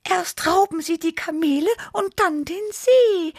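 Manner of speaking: very high, alarmed little cat voice; frightened meow. A narrator's reading. Tibi blames pirates for stolen camels, frightened meowing. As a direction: squeal it in mounting alarm. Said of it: "miaute Tibi erschrocken".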